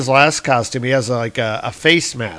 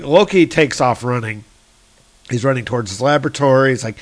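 usual speaking voice

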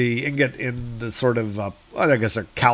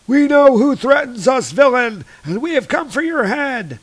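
high, loud put-on creature voice; menacing dark elves; 12 semitones higher, 4 dB louder than usual. Dark elves come for the villain's head, high loud threat.

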